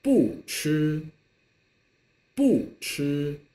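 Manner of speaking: sharp falling 不, then level high 吃; read-after-me model of 不吃; fourth-tone 不 for repeating. Shown as a demonstration. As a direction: drop on 不 and hold 吃 steady. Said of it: "now please read after me".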